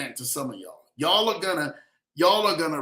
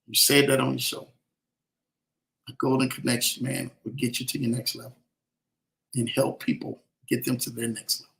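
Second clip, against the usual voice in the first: preaching; 4 semitones lower than usual. Acted. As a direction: preach it like a promise over the crowd. Livestream preaching to viewers.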